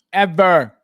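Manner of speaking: ordinary talk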